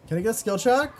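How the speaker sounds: "skill check" yelled high and loud; pleading yell. Real, not acted. Repeats louder, pleading.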